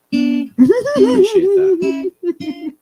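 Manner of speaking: very high, loud, drawn-out wail; mock whine. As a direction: stretch it into a pained whine. Mock thanks for being called old.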